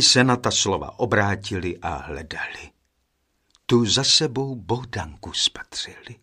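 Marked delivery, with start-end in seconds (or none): none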